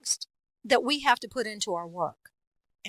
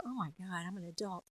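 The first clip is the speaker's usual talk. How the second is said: much softer, under the voice; quiet aside; 2 semitones lower, 14 dB quieter than usual. Acted. Voice actor reacting to herself, quiet aside. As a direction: drop to a small self-aware murmur. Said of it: "then you sort of go".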